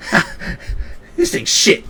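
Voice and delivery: very high mocking squawk; scoffing shopkeeper. Inventor judging his own old pistol, high scoff. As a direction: dismiss it with a high-pitched scoff. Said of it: "He goes".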